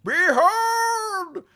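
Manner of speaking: high, loud and very slow, like a herald unveiling a relic; grand fantasy herald voice, slowed right down; epic proclamation. Pretend. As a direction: announce the sword to the world, drawn out. Proclaiming his new sword.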